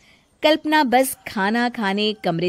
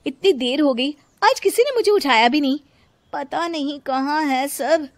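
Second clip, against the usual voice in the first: higher, sleepy and demanding; lazy, whiny daughter-in-law; 8 semitones higher, 5 dB louder than usual. Acted. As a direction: yawn out your hunger, then complain. Lazy wife wakes hungry.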